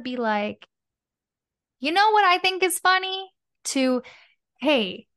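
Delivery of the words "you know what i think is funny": high, loud snide "funny"; passive-aggressive opener. Shown as a demonstration. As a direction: needle them with a loaded question. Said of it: "Do you see the difference?".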